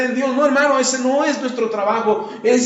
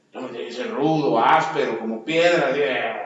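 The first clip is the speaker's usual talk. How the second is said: mock tough man; 9 semitones lower than usual. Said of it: "mocking the tough man ideal".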